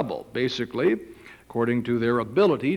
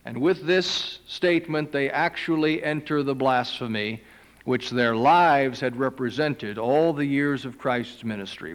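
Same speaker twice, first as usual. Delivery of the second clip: normal talking voice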